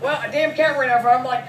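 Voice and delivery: usual speaking voice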